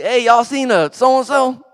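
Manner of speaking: loud, high, excited calling out; excited greeting. Retold or imitated. Preacher retelling, calling out to the dudes excitedly. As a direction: shout the greeting over the loud room.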